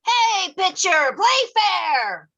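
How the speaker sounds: high mock heckle across the field; calling out. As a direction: yell it at the pitcher. Heckling from the bleachers.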